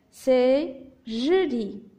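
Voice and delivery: very slow, stretched syllables. Shown as a demonstration. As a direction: sound it out slowly for young readers. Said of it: "j'ai séparé le texte en syllabes".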